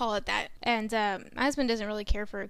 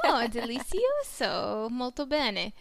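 slow, hammed-up Italian-accented praise; mock Italian; slower than usual. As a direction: savour it like a TV chef, in Italian. Joins the mock Italian bit.

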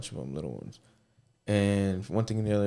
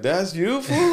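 much higher, louder, disbelieving; disbelief; 10 semitones higher, 7 dB louder, slower than usual. Retold or imitated. Friend seeing old photos, loud mock disbelief. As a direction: laugh at how he used to look. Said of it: "you'd be like".

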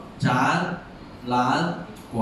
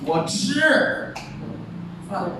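吃 jumps much higher; stress on 吃; 7 semitones higher, much slower than usual. Shown as a demonstration. Teacher moving the stress within the sentence. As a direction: make 吃 leap up in 我吃饭. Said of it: "重音给你放在不同的地方".

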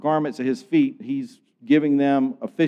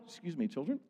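much softer, quick apologetic aside; hushed aside; 17 dB quieter, faster than usual. Real, not acted. Preacher to children mid-way through a violent story, hushed aside. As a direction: drop right down for an aside.

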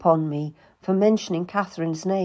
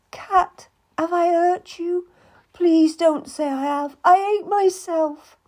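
higher, louder tearful lady's voice; pleading Anne; 11 semitones higher, 5 dB louder than usual. Acted. Anne apologizes to Cat, pleading and tearful. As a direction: beg forgiveness, voice rising.

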